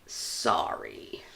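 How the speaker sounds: whispered mock apology. Real, not acted. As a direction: breathe the apology away.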